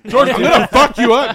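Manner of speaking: shouting through laughter; loud, laughing fake threat; laughing mock threat.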